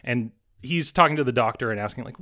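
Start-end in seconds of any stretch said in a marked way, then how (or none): none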